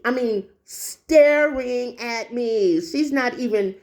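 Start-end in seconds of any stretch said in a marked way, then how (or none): 0.6-2.8 staring stretched out very slowly; emphatic STARING. Real, not acted. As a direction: draw out "staring" word by word. I mean, repeats it drawn out.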